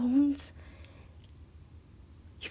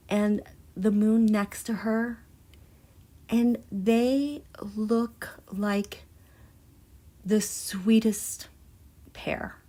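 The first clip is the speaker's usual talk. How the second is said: tender reverence; 4 semitones lower, 3 dB quieter than usual. Real, not acted. Moved, describing Earth and moon tenderly.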